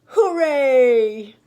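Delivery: loud distant shout; echo shouting back. A narrator's reading. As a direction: call back from across the pond. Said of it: "the echo shouted back".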